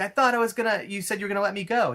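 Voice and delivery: mock desperate protest; betrayed pleading. Charlie protesting to the Joker, mock panic. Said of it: "He's like".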